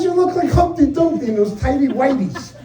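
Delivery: mocking through laughter; wife laughing at husband's underwear.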